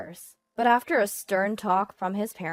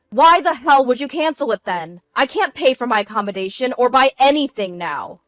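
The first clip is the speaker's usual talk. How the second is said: loud, raised-pitch indignation; angry outburst; 5 semitones higher, 11 dB louder than usual. A narrator's reading. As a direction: rage at your wife over the card. Husband rages over cancelled card.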